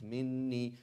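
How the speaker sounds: Minni with a long held double n; Minni, doubled n, smooth ending; smooth pronunciation. Shown as a demonstration. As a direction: lean into the nn of Minni. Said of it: "That's something I can actually pronounce".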